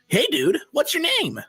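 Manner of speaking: high character voice. Acted. Voiced character asking name.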